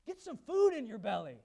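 Jesus as a passionate coach; rousing Jesus. Retold or imitated. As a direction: fire them up to go and live. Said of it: "Jesus said".

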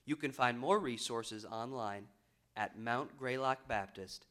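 low, measured announcer read; formal recorded outro; broadcast announcer sign-off. Real, not acted. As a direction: invite listeners courteously, evenly.

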